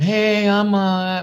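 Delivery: mock meek job seeker voice; schlepping job seeker. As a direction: play the unsure job hunter shuffling in. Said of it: "If you're going in there schlepping like".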